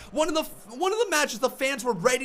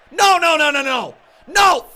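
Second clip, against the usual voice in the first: real outraged shouting; loud protest; 7 dB louder than usual. Real, not acted. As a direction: shout no over and over. Fan protesting the disqualification.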